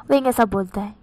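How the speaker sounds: ordinary delivery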